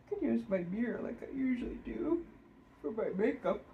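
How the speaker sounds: words stretched by a yawn. Real, not acted. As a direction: talk through a yawn. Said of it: "Sorry, as I yawn".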